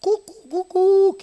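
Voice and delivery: high, slow rooster crow imitation. Preacher imitating the rooster after the denial, high and slow. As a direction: crow like the cock. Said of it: "Begitu tiga kali menyangkal".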